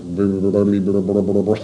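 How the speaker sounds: steady droning brr brr; buzzing brr noise. Shown as a demonstration. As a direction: hum a dull repeated brr. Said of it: "if I just sat up here and went like".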